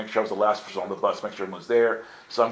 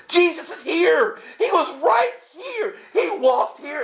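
very high mock-hysterical cry; freaking out. Pilgrim answering puzzled friends from the dirt, freaking out. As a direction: answer in a frantic, high-pitched wail. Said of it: "I'm freaking out".

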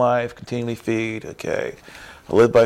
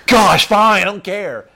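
huffy giving up; 8 semitones higher, 7 dB louder, slower than usual. Retold or imitated. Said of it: "You smile again".

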